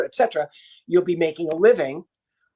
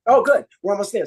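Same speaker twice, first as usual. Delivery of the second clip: ordinary delivery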